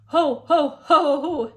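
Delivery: big loud giant laugh; giant guffaw. A narrator's reading. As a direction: belly laugh boomed out. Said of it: "laughed out loud, guffawing".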